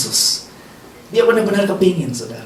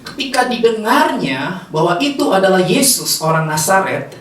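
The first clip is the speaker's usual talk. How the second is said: normal talking voice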